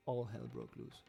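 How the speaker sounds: ordinary delivery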